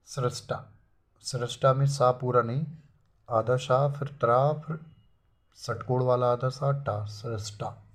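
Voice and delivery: usual speaking voice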